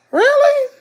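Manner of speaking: high, loud disbelieving question; incredulous. Real, not acted. Reacts to claim about fluoride in water.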